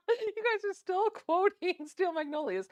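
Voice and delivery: high squeaky voice just after laughing; tickled and giggly; amused, laughing. Real, not acted. Cracking up at viewers' movie quotes.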